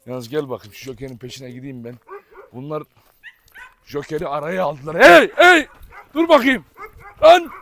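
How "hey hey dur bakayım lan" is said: loud, high alarmed call; real startled yell at the dogs; calling out sharply. Real, not acted. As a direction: shout to stop the dogs. Dogs cornering Joker, calling out.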